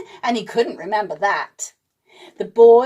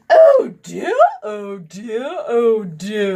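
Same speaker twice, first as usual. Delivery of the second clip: posh voice; 4 dB louder, slower than usual. A narrator's reading. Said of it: "announced a posh voice".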